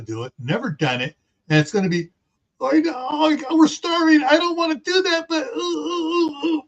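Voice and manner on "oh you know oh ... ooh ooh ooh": high, hammed-up crying whine; mock whining. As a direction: whimper like a starving person. Mocking starving people whining.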